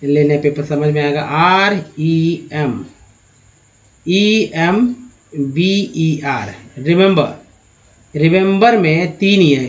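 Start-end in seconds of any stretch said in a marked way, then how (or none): 1.2-7.4 slow spelling out. Shown as a demonstration. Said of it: "एक word ले लेती है".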